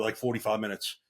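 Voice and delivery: ordinary talk, nothing marked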